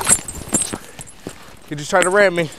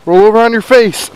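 loud command; 5 semitones higher, 11 dB louder than usual. Real, not acted. Ordering suspect onto face.

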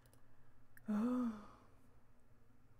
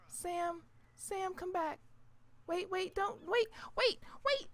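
calling out; 7 semitones higher, 4 dB louder than usual. Real, not acted. Calling the pet back.

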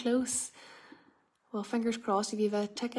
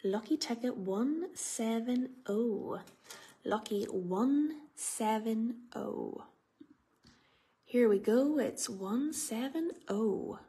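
slow drawn-out raffle call; bingo caller; slower than usual. Real, not acted. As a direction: stretch the number like a bingo host.

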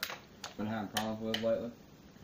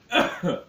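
spice cough; 8 semitones higher, 10 dB louder than usual. Real, not acted. Spicy food makes him cough.

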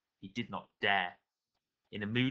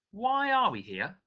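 put-on boy voice; 10 semitones higher, slower than usual. A narrator's reading. Daniel voiced, boy asking.

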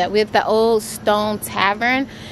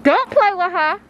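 high, loud New York accent; 11 semitones higher, 4 dB louder than usual. Shown as a demonstration. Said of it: "You heard my New York accent".

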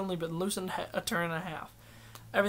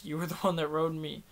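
usual speaking voice

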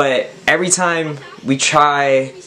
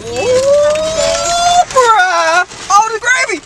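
excited; much slower than usual. Real, not acted. Food arrives.

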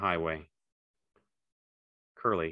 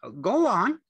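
high, loud, eager simple fellow; Lenny, eager and childlike; 7 dB louder than usual. A narrator's reading. Lenny prompting George to go on, high and eager.

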